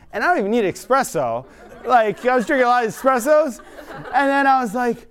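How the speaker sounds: cracking up at his own espresso habit; laughing. Real, not acted. Speaker telling the audience about his espressos, laughing.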